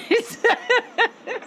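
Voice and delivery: bright, high laugh; high burst of laughter. Real, not acted. Laughing at own remark.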